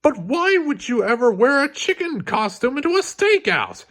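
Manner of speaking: high, breathless exasperated question; out of breath with disbelief; gasping Bob. Acted. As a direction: Bob gasping at his partner's chicken costume.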